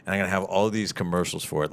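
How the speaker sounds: normal talking voice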